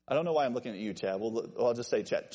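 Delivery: ordinary talk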